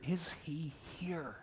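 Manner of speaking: a preacher hushing the congregation; whisper. Real, not acted. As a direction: breathe the question.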